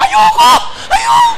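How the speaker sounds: high screaming refusal; mock panicked refusal. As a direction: shriek it in a hammed-up panic. Sister refuses when told go. (pabirong matinis na sigaw ng pagtanggi)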